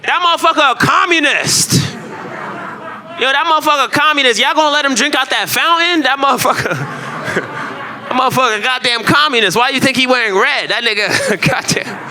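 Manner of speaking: high, laughing caricature of an angry bigot. Retold or imitated. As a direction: shout it up high while cracking up. Mocking racist bystander voice, high.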